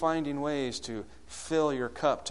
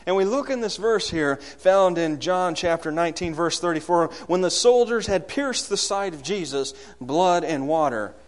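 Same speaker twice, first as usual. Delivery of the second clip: higher, louder sermon delivery; impassioned preaching; 5 semitones higher, 7 dB louder than usual. Real, not acted. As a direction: preach it out with rising force.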